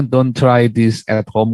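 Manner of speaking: slow, each word set apart; slow warning. Retold or imitated. Preacher warning viewers, slow and deliberate. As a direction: spell out the warning slowly. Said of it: "saya selalu bilang".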